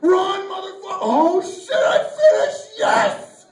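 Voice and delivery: high, loud frantic triumph; hammed-up ecstatic screaming; hugely excited shouting. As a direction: yell it like a climactic victory. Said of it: "And I get so excited".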